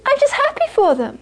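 very high, guileless voice; high-pitched mock innocence; innocent Geshe. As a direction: play a happily oblivious monk. Acting the innocent happy Geshe.

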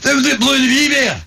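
Scottish stormtrooper. Retold or imitated. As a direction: yell it like a flustered Scottish trooper. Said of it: "one Scottish stormtrooper".

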